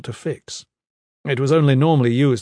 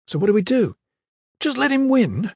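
raised, high-pitched indignant questions; furious; 11 semitones higher than usual. A narrator's reading. Kip challenges Iron Fist over giving in, furious. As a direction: protest angrily, pitch jumping up. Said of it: "Kip was furious".

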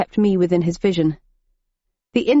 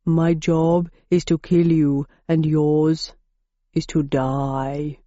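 slow, menacing Minotaur growl; basso profundo; 3 semitones lower, slower than usual. A narrator's reading. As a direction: lower and slower to the end. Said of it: "in a basso profundo".

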